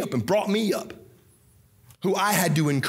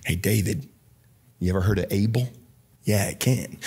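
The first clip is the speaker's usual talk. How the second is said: low aside to David; 12 semitones lower than usual. Acted. Preacher addressing David playfully.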